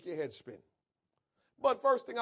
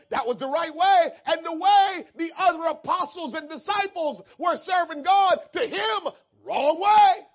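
high, loud preacher's shout; full-force preaching; 9 semitones higher, 13 dB louder than usual. Real, not acted. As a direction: preach it out at full volume, pitch lifted.

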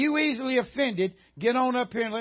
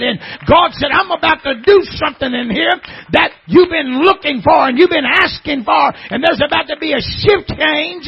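loud, higher, rising sermon shout; preaching at full force; 4 semitones higher, 10 dB louder than usual. Acted. Preacher proclaiming new life to the church, loud shout. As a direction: drive the scripture home with full force.

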